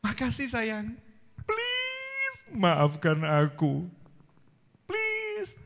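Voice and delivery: pleading husband. Retold or imitated. A sane husband sweet-talking his angry wife, wheedling. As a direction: coax her sweetly, stretching the please. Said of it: "Kalau yang waras kan".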